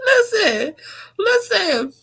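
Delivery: high voice still wobbling with laughter; real laughter fading out; catching breath after laughing. Real, not acted. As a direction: try to regain composure. Trying to stop laughing.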